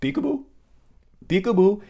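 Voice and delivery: put-on high, singsong baby talk; parent playing peekaboo. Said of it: "like watching parents play peekaboo with their baby".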